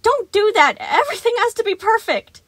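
very high, loud insistence; a perfectionist robot laying down law; shrill scolding. A narrator's reading. Sun scolding Gregory to keep everything perfect.

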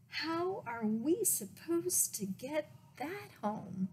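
high, slow incredulous question; dismayed mom. A narrator's reading. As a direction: draw out the disbelief. Mom's dismayed question in story.

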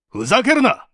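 angry shout. Acted. Interrogator shouting down the suspect's denial, furious. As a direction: bark it at the lying suspect.

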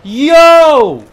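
sudden, very loud high yell; startled yell. Real, not acted. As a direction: yell "yo" in shock, drawn high. Startled yell mid game.

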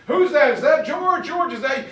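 high, quick-fire surprised questions; startled questioning. Retold or imitated. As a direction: call out in disbelief down the phone. Startled listener on the phone.

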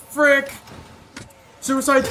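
high loud yell; shouting. Real, not acted. Killed in game.

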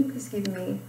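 normal talking voice

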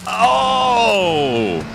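sudden high startled oh; surprised. Real, not acted. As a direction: blurt it out in shock. Startled by the game.

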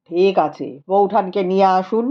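a calm scholar speaking gravely; Rupendra's voice. A narrator's reading. (নিচু গম্ভীর গলায় পণ্ডিত রূপেন্দ্র)

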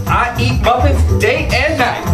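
normal talking voice

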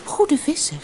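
high little fish voice; tiny goldfish calling up to the fisherman; talking goldfish. A narrator's reading. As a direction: plead in a high-pitched voice. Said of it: "Het was een gouden visje dat kon praten".

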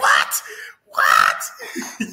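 high, shouted retold reaction; hammed-up disbelief. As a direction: yell it as if stunned by the bar. Acts out stunned reaction to bar.

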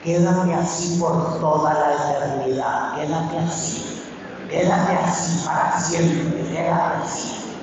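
slow, hushed, lulling mockery of easy comfort; mock soothing. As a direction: cradle them in false comfort, slowly. Mocking a lax, comforting preacher. (consuelo burlón, lento y susurrado)

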